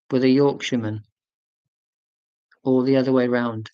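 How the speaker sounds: ordinary delivery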